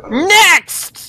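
high loud call of Next; a loud, high announcer-style call. Acted. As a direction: shout for the next traveller. Inspector calls the queue.

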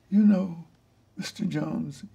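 lightly voiced honeyed secretary; a mild syrupy-polite greeting; saccharine sweet caller. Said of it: "dripping sweet with saccharin honey".

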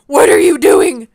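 high, loud sobbing shout. Pretend. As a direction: yell the question in mock despair. Staged argument between sims.

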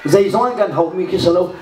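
ordinary talk

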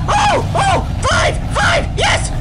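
high, excited yelps; frantic excitement. Real, not acted. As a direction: yelp each word out at a high pitch. Frantic cheer on finding the fifth.

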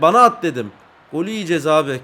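ordinary talk, nothing marked

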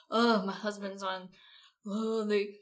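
whiny, loud complaining wife; complaining spouse. Retold or imitated. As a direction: whine about your husband being gone. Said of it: "spouses when they're complaining".

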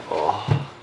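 ordinary talk, nothing marked

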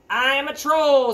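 loud, high troll declaration; grumpy troll. Acted. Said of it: "said Grumpy Old Troll".

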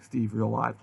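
usual speaking voice

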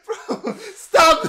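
high, loud "stop it" through laughter; laughing shout. Real, not acted. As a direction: yell it back at the clip while cracking up. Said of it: "quoting clip while laughing".

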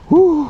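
very high, loud whoop; triumphant whoop. Real, not acted. Angler celebrating big catch, triumphant whoop. Whooping after landing fish.

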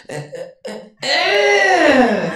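strained grunts building to a high, loud groan; hammed-up toilet strain. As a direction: strain like someone pushing hard on the toilet. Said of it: "escuchas al de al lado como".

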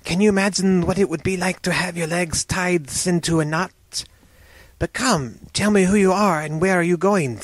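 sibilant snake voice, pitched a little up; serpent man. Acted. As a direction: hiss the s sounds as a snake turned man. Serpent man chats with rescuer.

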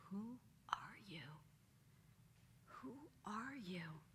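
slow, hushed whisper; half-afraid wondering to himself; whispered "who are you", slow; awed whisper. Retold or imitated. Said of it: "is he looking at him like".

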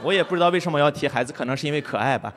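ordinary talk, nothing marked

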